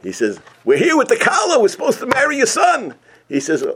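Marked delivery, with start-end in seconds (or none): 0.6-3.0 excited arrival. Acted. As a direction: announce yourselves at the door. Family claims the match.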